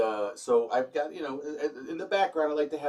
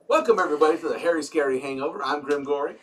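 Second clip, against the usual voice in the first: loud announcer welcome; show host intro; 8 dB louder, faster than usual. Acted. Host welcoming viewers to the Harry Scary Hangover. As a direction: open the show like a booming host.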